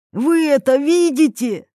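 high, excited calling question; eager, thrilled exclamation; joyful shout. A narrator's reading. Yuri showing Irina the highway, joyful shout. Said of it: "закричал он, радостно".

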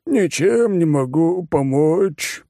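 high, slow, said through a yawn; bored fat oligarch yawning. Acted. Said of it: "зевнул Жердяй".

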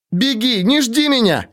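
high urgent shout. A narrator's reading. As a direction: yell it, pleading him to run. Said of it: "крикнула Джордану Кай".

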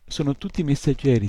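plain reading voice

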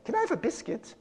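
put-on high, soft British little-kid voice; British child. Child asking a parent for a treat, high British kid voice. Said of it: "What's a British thing?".

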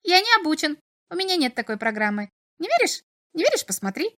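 high, protesting little imp. A narrator's reading. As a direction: plead innocence as the imp.

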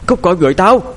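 high, loud deranged scream; frenzied woman driving something away; crazed shout. A narrator's reading. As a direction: scream it out as if possessed. Possessed woman's demand escalates.

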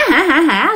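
acted "ha ha ha" cackle; spirit's mocking laugh. Possessed aunt starts laughing at the family, acted cackle. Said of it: "Bắt đầu cười".

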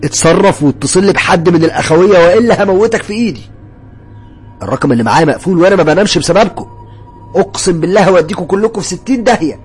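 loud, high, furious threat; full-force yelling. A narrator's reading. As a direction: roar threats while grabbing his neck. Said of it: "بزعق فيه بكل قوة". (زعيق وتهديد بكل قوة)

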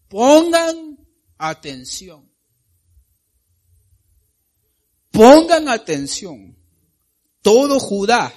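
high, loud, slow proclamation; slow booming prophetic cry to the king and people; prophet proclaiming. Acted. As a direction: call out to all Judah like a prophet.

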